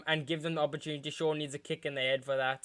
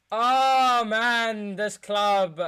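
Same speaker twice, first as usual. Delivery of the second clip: loud exasperation; 6 semitones higher, 7 dB louder, much slower than usual. Real, not acted. Fan exasperated at his club.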